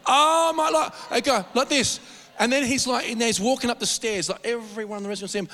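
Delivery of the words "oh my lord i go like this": normal talking voice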